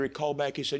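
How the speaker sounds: ordinary talk, nothing marked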